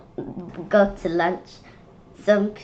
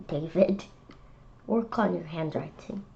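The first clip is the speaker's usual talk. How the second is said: usual speaking voice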